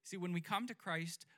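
usual speaking voice

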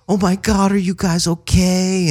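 high, alarmed hippie voice; a peace-and-love guy suddenly scared; worried hippie. Retold or imitated.

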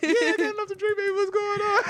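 high falsetto girlfriend impression; mock girlfriend. Girlfriend answering boyfriend over dinner, mock falsetto. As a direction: put on a very high, sweet-talking woman's voice. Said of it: "She's like".

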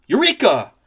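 loud triumphant Eureka; eureka shout. Acted. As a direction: shout it like a discovery. Sudden realization.

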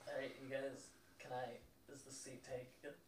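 put-on soft, timid nerdy boy; lightly voiced, soft and shy; shy kid voice. GM voices shy classmate Paul.

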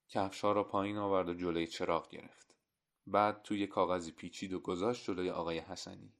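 usual speaking voice

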